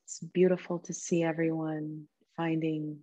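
soft, calm meditation voice with long pauses; guided meditation. Real, not acted. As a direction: gently guide them into stillness, leaving space between phrases.

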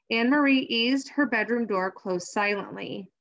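ordinary delivery, nothing marked

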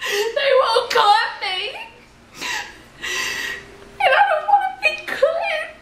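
high squealing laugh through the words; can't stop laughing while talking; laughing so hard it nearly turns to crying; hysterical laughing. Real, not acted. Laughs through scared confession.

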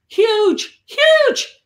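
"huge" pitched high and loud, punched each time; emphatic retold HUGE. Nan describing a woman down the road, emphatic "huge". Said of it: "She said".

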